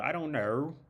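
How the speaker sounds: mock Steve Irwin Aussie voice; playful Australian accent. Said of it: "what's the dude who died, who was the, the animal guy".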